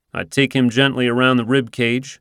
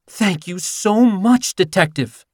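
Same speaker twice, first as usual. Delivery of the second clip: higher, slow, breathy-sounding admiring thanks; flirty thanks; 8 semitones higher, slower than usual. Acted. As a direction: flirt with the handsome detective. Flirting owner.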